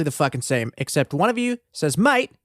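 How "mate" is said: high, excited Australian "mate". Retold or imitated. Podcaster mimicking Australian listeners, excited Australian accent. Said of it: "in a really exci".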